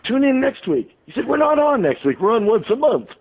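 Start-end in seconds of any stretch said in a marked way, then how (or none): 0.1-0.9 flustered sign-off. Retold or imitated. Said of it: "I was flabbergasted". 1.3-3.1 flustered correction. Retold or imitated. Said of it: "I was flabbergasted".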